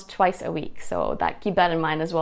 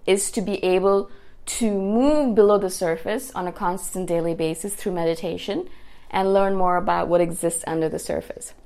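ordinary delivery

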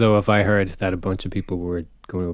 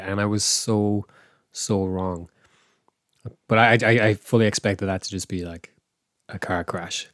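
normal talking voice

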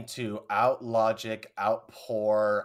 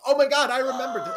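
much higher, fast gushing fan; giddy fan squeal; mock fan excitement; 7 dB louder, much faster than usual. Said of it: "they were like".

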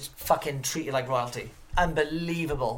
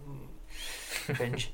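much softer, flat mock disgust; deadpan cringe; 11 dB quieter than usual. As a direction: mutter it under your breath. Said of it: "I was like".

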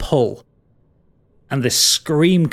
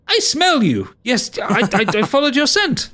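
much higher, eager sniffing voice; hungry whiff of something tasty; sniffing creature; 9 semitones higher than usual. Acted. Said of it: "turns to you, Calbo, and goes".

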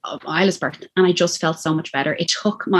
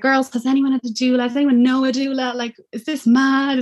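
eager excited question; 7 semitones higher than usual. Retold or imitated. Asking her Facebook group.

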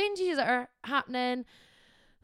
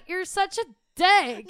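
slow, louder, higher mock snap; mock aggressive; 6 semitones higher, 6 dB louder, slower than usual. Retelling the phone call, she mimics a mock aggressive snap. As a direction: play at being mad at a friend. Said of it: "She was so aggressive".

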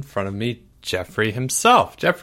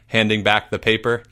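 plain reading voice

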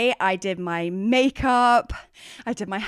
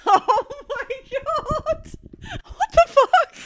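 very high, loud laughing disbelief; shrieking with laughter at the story; shocked laughing; 3 dB louder, much slower than usual. Real, not acted.

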